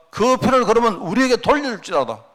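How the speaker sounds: hammed-up cry of an angry mob; crowd's mock demand. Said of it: "하는 거예요".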